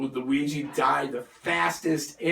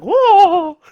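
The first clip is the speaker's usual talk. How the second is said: startled whoa; 13 dB louder than usual. Retold or imitated. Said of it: "He's like".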